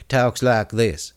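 lightly put-on southern accent; mock southern accent. Mocking the faker from Edison, mock southern accent. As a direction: imitate a fake southern drawl. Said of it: "talks with kind of a southern accent".

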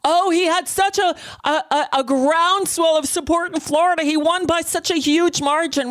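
high, sneering mock-gush about the margin; exaggerated fake-excited spin; mocking liars' boast. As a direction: parody the spin, voice pitched well up. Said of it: "lied to the American people by saying".

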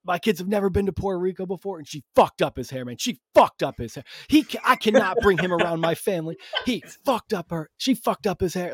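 high-pitched retold rant from a panicked dad; imitating frantic upset. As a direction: rant like a neighbor losing his mind. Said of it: "he's losing his goddamn mind".

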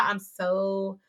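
ordinary talk

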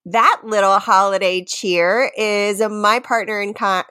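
ordinary talk, nothing marked

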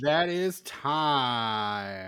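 very slow, drawn-out call; long-held words, like an announcer; calling time. Real, not acted. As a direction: stretch out the time's-up announcement. Calling time on timed recap.

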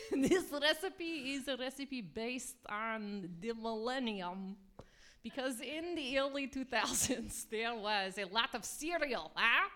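lightly put-on bad Russian accent; hammy Russian cooking host. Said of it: "speak in bad Russian accents".

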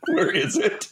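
high mock yell; lightly imitated scream at a gamer. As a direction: squeal it like a stranger bursting in. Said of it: "a strange voice comes in and screams".

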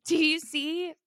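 a hint of a nosy old shop lady; imitating old lady. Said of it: "she was like".

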